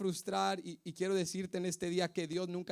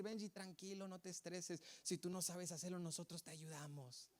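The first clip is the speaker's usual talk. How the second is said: soft, gentle reassurance, much quieter; imagined kind coworkers; 9 dB quieter than usual. Retold or imitated. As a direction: coo it like friendly coworkers calming a newbie.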